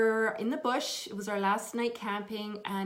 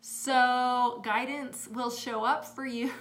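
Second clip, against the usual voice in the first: normal talking voice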